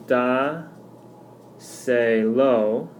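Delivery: "da, se, lo" in three separate syllables. Shown as a demonstration. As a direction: pause after each syllable: "da", "se", "lo". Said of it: "now we have three syllables".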